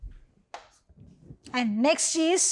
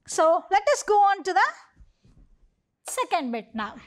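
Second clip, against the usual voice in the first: ordinary talk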